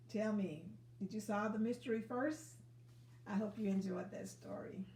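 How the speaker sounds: ordinary delivery, nothing marked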